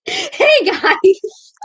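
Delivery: very high, squeaky greeting through laughter; exaggerated hyped greeting. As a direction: push the video intro into a giggly squeal.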